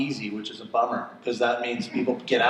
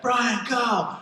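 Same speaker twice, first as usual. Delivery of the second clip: high call; 10 semitones higher than usual. Retold or imitated. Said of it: "He's like".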